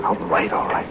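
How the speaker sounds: whispered all right to calm her; whispered soothing. Acted. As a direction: hush her, barely voiced. Calming frightened wife, hushed.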